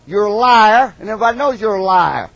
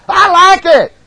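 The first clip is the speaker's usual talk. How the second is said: excited shout; 12 semitones higher, 8 dB louder, slower than usual. Real, not acted. Preacher exclaiming over praise.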